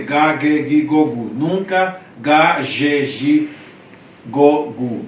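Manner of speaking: ga, ge, gi, go, gu all with a hard g, loud; syllable drill for hard gamma. Shown as a demonstration. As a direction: keep every g hard like in gato. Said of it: "O gama sempre tem som duro".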